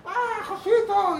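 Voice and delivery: put-on high, slow child voice; excited kids. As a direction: kids clinging and calling his name. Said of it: "niños enganchados a sus piernas". (niños llamándolo con voz aguda y lenta)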